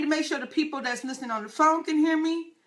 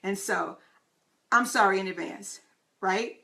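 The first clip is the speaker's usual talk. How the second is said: ordinary talk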